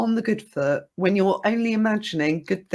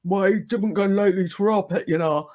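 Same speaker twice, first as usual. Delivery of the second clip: thick Geordie slur after the pub; light Geordie impression; Geordie accent. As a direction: drunk Geordie brother, slurred. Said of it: "very like".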